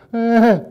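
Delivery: a loud high burst of laughter; loud laughing burst. Real, not acted. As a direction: break into a big laugh. Laughing at childhood memory.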